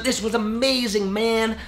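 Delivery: high, hammed-up American enthusiasm; bad American accent. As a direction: rave in a badly faked American accent. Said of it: "how bad my American accent is".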